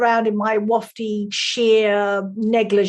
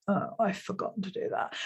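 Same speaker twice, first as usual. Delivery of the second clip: much softer, sheepish admission; sheepish aside; 4 semitones lower, 13 dB quieter than usual. Retold or imitated. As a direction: drop to a near-murmur, caught out. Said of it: "And I was like".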